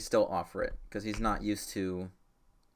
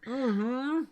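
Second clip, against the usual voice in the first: muffled mm-hmm; 13 semitones higher, 3 dB louder than usual. Acted. Kid agrees, mouth still full.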